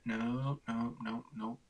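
normal talking voice

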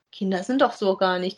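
normal talking voice

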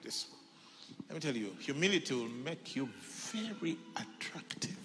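whispered aside; confiding whisper. Real, not acted. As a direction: lean in and whisper it.

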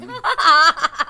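a loud, sudden laugh; burst of laughter. Real, not acted. Laughing at her own joke about new acquaintances, loud laugh. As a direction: let a real laugh burst out.

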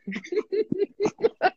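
shrill giggle burst; high burst of laughter. Real, not acted.

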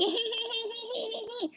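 high, breathy giggle trailing down. Real, not acted. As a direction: giggle like you're flattered. Giggling at the boys' attention.

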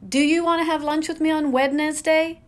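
Wednesday said as Wed-nes-day in a mock question; Wednesday as spelled. Shown as a demonstration. Vlogger mocks a speaker saying Wednesday letter by letter. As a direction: sound the D and the middle syllable of Wednesday.

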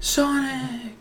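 high, far-off cartoon cry of Sonic; a hammed-up high shout of a name; mock cartoon call. Imitating a character calling.

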